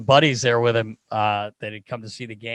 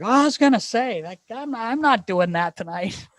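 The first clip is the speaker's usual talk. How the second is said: Phil Kessel; 10 semitones higher, 3 dB louder than usual. Retold or imitated. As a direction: imitate a teammate backing down. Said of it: "but he's like".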